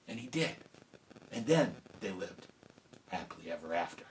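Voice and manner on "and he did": ordinary delivery, nothing marked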